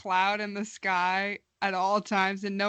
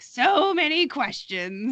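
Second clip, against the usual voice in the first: slow, higher mock dad brushing off a question; 5 semitones higher, slower than usual. Listener mimics the dragon as a dismissive dad, slow and mocking. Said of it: "Such a dad move".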